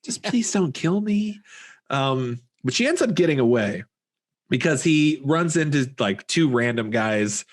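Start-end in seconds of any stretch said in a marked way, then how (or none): 0.0-1.4 higher-pitched, halting, tentative plea; understated begging. Retold or imitated. Girl trying to reason with her kidnapper, understated begging. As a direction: bargain gently in a raised voice, mildly pleading. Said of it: "kinda begging, but not even in this over the top".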